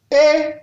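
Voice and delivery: Et held on a high, level tone. Shown as a demonstration. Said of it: "Ton haut". (« Et » au ton haut)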